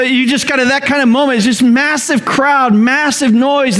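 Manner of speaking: ordinary talk, nothing marked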